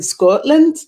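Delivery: light mock Scottish accent; a hint of a put-on Scottish accent. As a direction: say the place name with a playful Scottish lilt. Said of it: "That was my t-, uh, Scottish accent".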